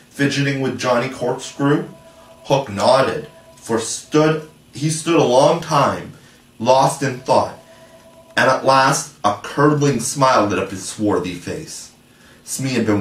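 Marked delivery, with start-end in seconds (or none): none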